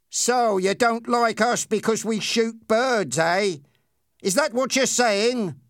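high-pitched, confrontational questioning; slow-starting, needling bully; bully Ernie, challenging. Acted. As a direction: pick a fight with the question.

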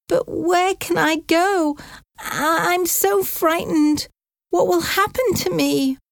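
high, quavering worried aunt voice; an elderly aunt scared of losing her home; frightened old aunt. Acted. Aunt Sarah fearing she must leave, high and frightened.

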